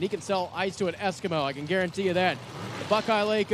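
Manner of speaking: ordinary delivery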